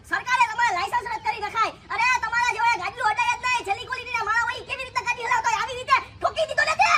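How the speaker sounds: high-pitched angry scolding; scolding a reckless driver, shrill and angry. Acted. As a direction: chew him out for hitting the man.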